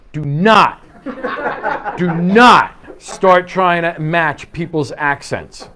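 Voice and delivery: "Do not" punched loud, then drawn out; emphatic warning. Real, not acted. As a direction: slam the word "not" loudly both times.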